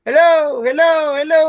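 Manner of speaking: loud high hellos bouncing back; repeating echo. Shown as a demonstration. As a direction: play a big cave echoing several times. Slow repeated echo in a big cave.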